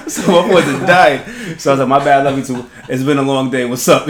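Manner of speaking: loud, amused retelling over laughter; loud comic storytelling, cracking up. Retold or imitated. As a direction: tell the punchline loudly through the laughs. Retelling punchline amid the room's laughter.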